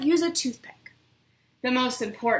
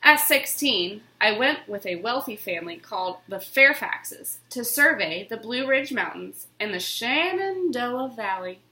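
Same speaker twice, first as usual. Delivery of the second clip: usual speaking voice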